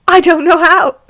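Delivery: put-on high, loud child voice; hammed-up kid's protest; little child. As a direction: pipe up like a helpless little boy. Child Harry voiced in story.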